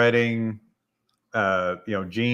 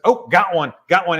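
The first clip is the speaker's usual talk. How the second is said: higher, louder imitated announcement; excited call-out; 6 semitones higher, 6 dB louder than usual. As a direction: act out suddenly hooking a fish. Retold angler hooking a fish.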